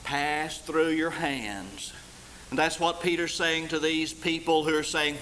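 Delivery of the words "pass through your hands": very slow, deliberate line; slow emphasis. Shown as a demonstration. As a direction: let it drip slowly. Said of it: "You've just let it what?".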